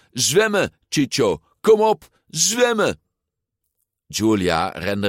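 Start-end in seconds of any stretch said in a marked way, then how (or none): 0.1-3.0 high-pitched urging yell; urgent shout. A narrator's reading. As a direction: shout them on from the shore.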